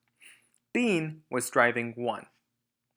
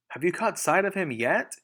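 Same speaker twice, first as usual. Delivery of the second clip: higher, louder call across; 6 semitones higher, 5 dB louder than usual. Acted. Bean calling back to Boggis, higher and louder. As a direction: call back over the engines. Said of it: "Bean called back".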